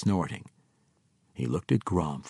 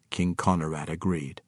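usual speaking voice

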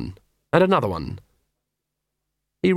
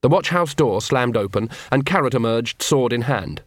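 ordinary delivery

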